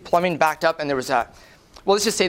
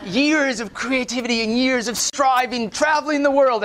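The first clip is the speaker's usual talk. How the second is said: high, laughing exasperation; laughing mock despair, pitched high; exasperated disbelief; 7 semitones higher, slower than usual. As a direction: throw up your hands laughing. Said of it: "And I was like".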